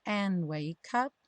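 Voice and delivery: slow "and wake up" with no gaps; three words linked. Shown as a demonstration. Teacher repeating the junction, slow linked "and wake up". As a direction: run "and wake up" together slowly. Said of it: "juntando as três palavras".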